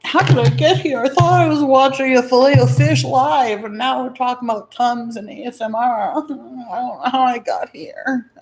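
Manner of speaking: high loud baffled voice; bewildered exclaim. Pretend. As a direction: play the lost confused viewer. Mock bewildered outburst.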